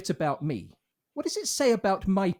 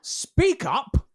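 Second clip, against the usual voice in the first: loud, higher-pitched bullying order; sudden raised-voice rebuke; angry command; 7 semitones higher, 6 dB louder than usual. Acted. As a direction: order her sharply to project. Angry actor orders her to speak up.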